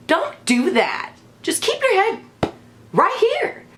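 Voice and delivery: high, slow, pleading scold; shrill-free but high, drawn out like scolding a wet client; mock scolding stylist. Stylist scolding a client who lifts her head, high slow plea.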